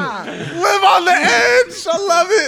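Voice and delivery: high, slow, crying with laughter; laughing shout. Real, not acted. Laughing reaction.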